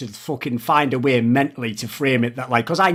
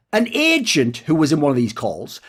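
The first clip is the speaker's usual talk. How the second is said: ordinary talk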